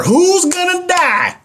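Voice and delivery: sudden high, slow dramatic wail; odd high voice. Real, not acted. Instructor asking students, in a sudden high drawn voice. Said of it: "I don't know why I said it like that".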